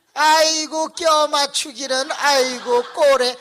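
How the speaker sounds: high, drawn-out jeer; mocking scoff. Deacon scoffing at the pastor's forced explanation, high mocking drawl.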